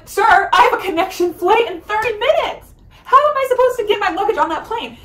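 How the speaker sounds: loud, high-pitched indignant plea; frantic protest. Retold or imitated. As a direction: raise the voice high, desperate to make the agent understand. Retold panicked protest to border agent.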